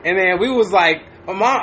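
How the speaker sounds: ordinary delivery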